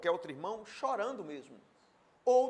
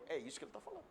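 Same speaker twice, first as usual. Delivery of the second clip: hushed drop; 10 semitones higher, 8 dB quieter, much faster than usual. Acted. Sudden drop after climax.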